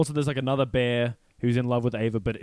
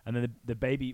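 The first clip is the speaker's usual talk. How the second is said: usual speaking voice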